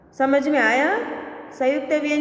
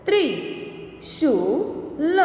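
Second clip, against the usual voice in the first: slow, each sound drawn out, rising at the end; slow drawn-out reading; 2 semitones higher, slower than usual. Shown as a demonstration. Teacher slowly sounding out a word for students, drawn out. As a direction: stretch the word slowly for the class. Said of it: "के उदाहरण हम देखें".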